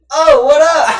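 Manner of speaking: loud, high retold surprise; startled greeting. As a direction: act out suddenly noticing a visitor. Acted startled greeting to unnoticed visitor.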